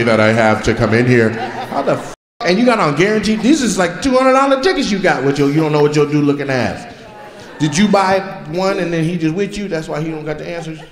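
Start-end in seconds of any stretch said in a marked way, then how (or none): none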